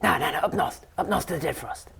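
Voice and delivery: a hint of a pixie insisting; insistent fairy. Pixie overruling the satyr, lightly voiced and insistent. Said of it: "And he goes".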